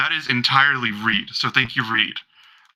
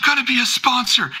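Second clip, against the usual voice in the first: much higher thrilled repeat; overexcited fan; 10 semitones higher than usual. Acted. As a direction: squeal the news again. Repeating the fan's exclamation.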